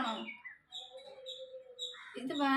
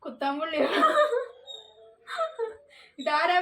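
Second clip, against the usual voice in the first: laughing; 9 semitones higher, 3 dB louder, slower than usual. Real, not acted. Laughing while guessing.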